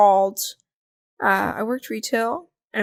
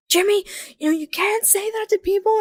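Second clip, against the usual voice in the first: high-pitched retold motherly scolding; mortified mother; 9 semitones higher than usual. Mother to five-year-old son after his question, mortified. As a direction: imitate a mother correcting her child.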